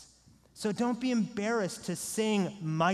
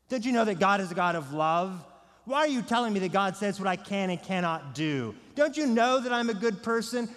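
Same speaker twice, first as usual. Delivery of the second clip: acted indignant protest, raised voice; self-righteous indignation; 4 semitones higher, 5 dB louder than usual. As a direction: push back like someone wrongly accused. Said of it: "with self-righteous indignation".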